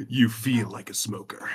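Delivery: low, slow, quiet ominous narrator; lightly voiced inner-voice narrator; game narrator voice. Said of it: "I'm making a Disco Elysium joke".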